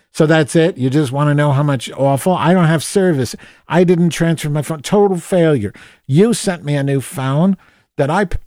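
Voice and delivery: high, loud, indignant complaint; exasperated rant. Retold or imitated. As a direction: vent fury at the useless company. Furious reply to survey text.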